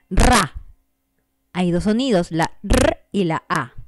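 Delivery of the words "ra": Ra said as one clear beat; syllable demo. Shown as a demonstration. Teacher showing students one syllable, spoken crisply. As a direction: give Ra as a single sounded syllable. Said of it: "vamos a dar un ejemplo".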